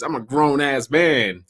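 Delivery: slow, "grown ass man" stressed; slow emphatic "grown ass man". Shown as a demonstration. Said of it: "just to add the emphasis".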